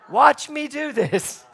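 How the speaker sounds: put-on high, loud, drawn-out kid's holler; retold child's scream. Boy calling the host out to watch, imitated high yell. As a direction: imitate a boy yelling for an audience. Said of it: "they screamed into me".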